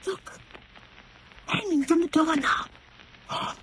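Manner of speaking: slow, hushed and fearful; softly horrified, staring at the door; frightened hush. Acted. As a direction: breathe it out in dread, barely audible. Sees dead cobra on door.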